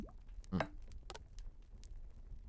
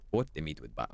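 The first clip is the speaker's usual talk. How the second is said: softer "Put the meat with butter" in a mock Italian accent; poor Italian impression; 5 semitones lower, 5 dB quieter, faster than usual. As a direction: murmur the recipe like a fake Italian cook. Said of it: "I'm doing a poor Italian impression".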